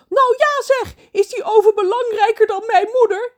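high, loud indignant shriek; shrieking mermaid. Acted. Mermaid Trudy shrieking at Ron about her mother, high and loud. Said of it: "gilt Trudy".

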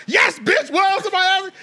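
high, loud exasperated snap; angry rant. Retold or imitated. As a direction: go off, fed up. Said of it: "she was like".

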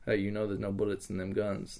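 sly aside. Retold or imitated. Taunting captor with empty guns.

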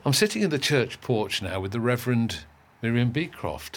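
usual speaking voice

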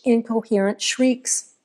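plain reading voice

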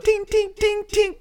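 high, ringing ting sounds; imitated tinging. Describing worrying bottle noises to co-host, high imitated tings. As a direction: mimic teeth or a ring tinging on glass. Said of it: "sounds as she was going down".

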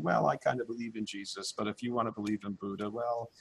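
soft, noncommittal mumbling imitation; mock timid believer. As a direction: play someone backing away from their own belief. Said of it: "standing back in the corner and going".

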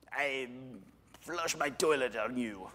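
hammed-up French-accented insult; mock French accent. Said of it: "I don't know why that was French".

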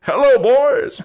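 high, loud jolly greeting; cheery NPC voice. Acted.

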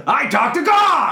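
put-on high, roaring drunk fanatic; crazed shout. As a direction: bellow like a zealot gone wild. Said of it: "He says".

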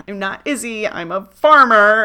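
hammed-up earnest declaration; mock insistent Izzy. Retelling Izzy's line, loud and slowed.

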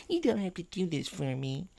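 high, soft mocking singsong; imitating Eric. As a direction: mimic her in a tiny high voice. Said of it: "and then Eric goes".

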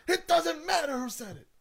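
very high, strained mock yell; mock outburst. Co-host dismissing who said the line, mock yelling. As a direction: cut them off with a squealed pretend outburst.

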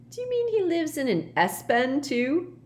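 high, teasing question; rather amused. A narrator's reading. Ron teasing Myrtle about the boy, amused. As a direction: poke fun with mock curiosity. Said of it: "sounding rather amused now".

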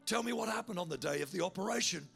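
ordinary delivery

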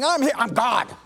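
curt mock-defensive God voice. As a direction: play a huffy, prickly God. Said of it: "he's not gonna get defensive going".

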